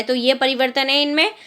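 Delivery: usual speaking voice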